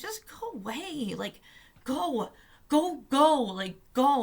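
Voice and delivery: high, exasperated mock plea; retold exasperation. Protester wanting annoying guard gone, exasperated. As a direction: repeat go in a high, fed-up voice.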